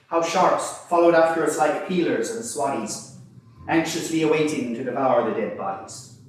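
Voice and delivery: poem recital. Acted. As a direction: recite it like a rousing verse.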